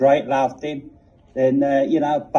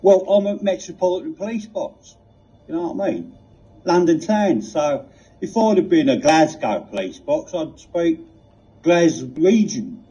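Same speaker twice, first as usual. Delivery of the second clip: put-on Cockney accent. The TARDIS answering Reg, in a world-weary Cockney accent. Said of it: "such a pronounced, uh, Cockney accent".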